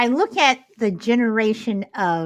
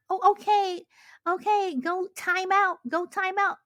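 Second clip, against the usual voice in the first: much higher, sing-song pushover parent, slowing; mocking lenient parent; 9 semitones higher than usual. Mocking soft modern parents.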